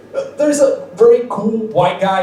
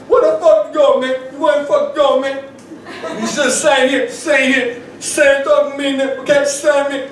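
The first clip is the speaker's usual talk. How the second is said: high insistent rapper demand; mock angry rapper; 9 semitones higher than usual. Rapper orders comic to stay, high mock anger. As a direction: block him from leaving. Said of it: "And he was like".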